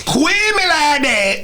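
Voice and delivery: "Quit me like that" high, slow and slurred; "Quit me like that" in a high, drawn-out rapper imitation; mock garbled English. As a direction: smear "Quit me like that" into one mangled word. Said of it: "It's not English".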